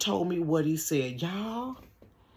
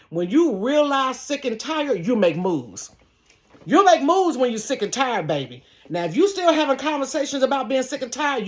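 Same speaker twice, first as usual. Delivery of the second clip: raised, emphatic sermon-style advice; preaching; 6 semitones higher, 5 dB louder than usual. Real, not acted. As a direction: preach it to the congregation.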